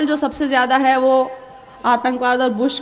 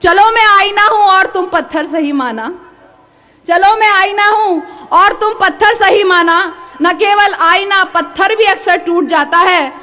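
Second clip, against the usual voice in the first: raised, emphatic mushaira delivery; measured Urdu verse, each phrase projected; poetry recital; 5 semitones higher, 6 dB louder than usual. Acted. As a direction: declaim the couplets to an audience. Poet recites couplets to audience.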